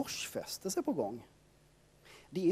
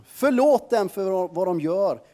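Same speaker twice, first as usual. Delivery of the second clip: calling out; 4 semitones higher, 9 dB louder than usual. A narrator's reading. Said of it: "ropar mannen".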